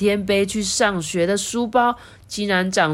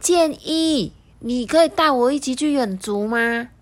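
higher, timid request; a hint of a strange little voice; 7 semitones higher than usual. The schoolbag asking to come along, timid and higher. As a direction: ask shyly, like an unseen creature.

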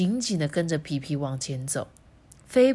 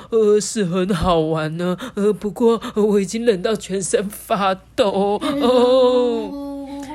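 loud, stuttery shivers between phrases; shivering with cold; 2 semitones higher, 6 dB louder, slower than usual. A narrator's reading. Monkey freezing in Antarctica, shivering all over. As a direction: shudder through the words, teeth-chattering. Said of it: "打着哆嗦说".

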